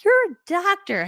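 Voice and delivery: high, slow awestruck marvel; tickled awe. Retold or imitated. Coach marvels at her doctor clients, tickled. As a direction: marvel at it, delighted and impressed. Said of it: "I'm always tickled by that".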